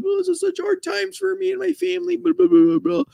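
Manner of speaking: high, whiny put-on voice mocking her; mocking Hilaria Baldwin's hard-times plea in a high whiny voice; mock self-pity. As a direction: parody a wife playing the victim, high and whiny. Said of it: "Hilaria Baldwin is one that's like".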